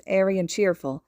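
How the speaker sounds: ordinary delivery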